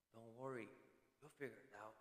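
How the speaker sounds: quiet, low, laid-back; a relaxed old hand calming the new guy; soft easygoing reassurance. Retold or imitated. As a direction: brush off the worry gently. Bob reassures new worker.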